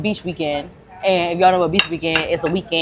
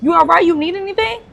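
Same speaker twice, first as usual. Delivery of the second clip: much higher, caring check-in; higher concerned voice to a stranger in trouble; 9 semitones higher than usual. Retold or imitated. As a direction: reach out to a woman in trouble. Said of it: "So I'm like".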